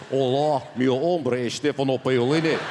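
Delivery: low-pitched Glaswegian Spanish; Glaswegian accent. Shown as a demonstration. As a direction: speak Spanish like a broad Glaswegian. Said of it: "Spanish with a Glaswegian twist".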